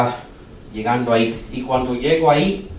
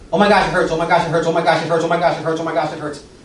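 retold screaming in pain; 5 semitones higher than usual. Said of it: "y ella gritando".

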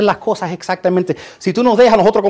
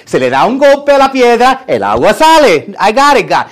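cocky Moses; 5 semitones higher, 6 dB louder than usual. Retold or imitated. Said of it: "Moisés dice".

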